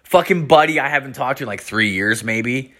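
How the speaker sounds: ordinary talk, nothing marked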